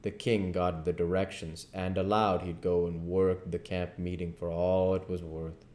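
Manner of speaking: plain reading voice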